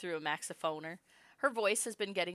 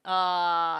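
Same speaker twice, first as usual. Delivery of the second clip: ordinary delivery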